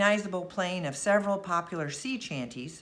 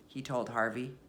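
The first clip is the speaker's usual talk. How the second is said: usual speaking voice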